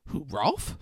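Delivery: lightly puzzled, rising "Who, Rolf?"; puzzled question. Retold or imitated. Brother asked about Rolf's teen years, mildly puzzled. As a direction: ask "Who, Rolf?" with mild surprise.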